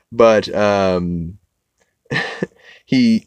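ordinary delivery

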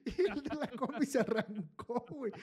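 high giggle through the words; laughing. Real, not acted. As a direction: laugh as you tell it. Laughing while telling.

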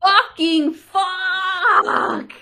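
very drawn-out, high, loud curse; anguished curse. Real, not acted. Player groaning at the axe strike, long high loud curse. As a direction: stretch the swear out in despair. Dismay at bad turn.

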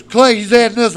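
objecting wife. Retold or imitated. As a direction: imitate an upset wife objecting.

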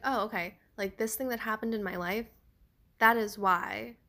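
ordinary talk, nothing marked

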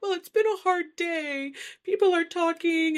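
high-pitched mock self-pity; imitated tearful complaint. Retelling Kathy crying to Jerry about her day, mock tearful. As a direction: play up the woe, voice pitched high. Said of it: "all of a sudden she starts crying".

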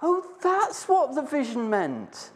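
high, slow "oh" of sudden understanding; dawning realisation. Acted. Peter finally grasping the vision, high slow realisation. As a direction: let the penny drop slowly. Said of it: "And what point did he go".

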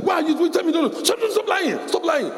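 high, sharp mock scolding; mock-shout stop lying; scolding parent. Parent accusing child of lying, high mock scolding.

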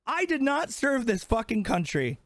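ordinary delivery

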